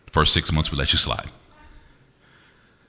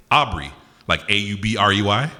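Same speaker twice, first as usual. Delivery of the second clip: ordinary delivery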